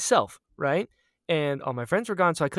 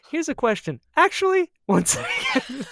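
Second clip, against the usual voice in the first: teasing imitation; 10 semitones higher, 4 dB louder than usual. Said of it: "I love that you're like".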